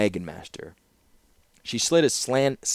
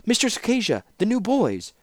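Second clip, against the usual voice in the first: put-on breathless young girl's cry, much higher; urgent call; 4 dB louder than usual. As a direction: run up calling out in alarm. Student runs up calling urgently.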